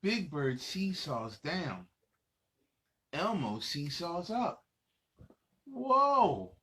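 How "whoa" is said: ordinary delivery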